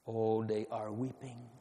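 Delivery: low, soft, tender lament; hushed sorrow. Real, not acted. Preacher telling of children weeping in their hearts, hushed sorrow. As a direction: let the voice sink gently in pity.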